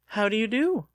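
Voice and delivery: a hint of a prim young lady; lightly voiced young lady. A sister greeting Lucas at the apple barrels.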